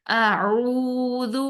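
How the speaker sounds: اعوذ slow, its ذ with fully rounded lips. Shown as a demonstration. Teacher showing the rounded ذ in اعوذ. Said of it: "زال کی پیش کو پورے گول ہونٹوں سے ادا کریں گے".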